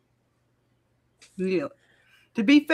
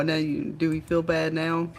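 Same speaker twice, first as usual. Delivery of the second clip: ordinary talk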